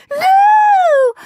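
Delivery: long, very high, loud scream. A narrator's reading. Peter protesting at his brother barging past, screaming. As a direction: scream it out in a piercing, drawn-out cry. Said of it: "screamed Perfect Peter".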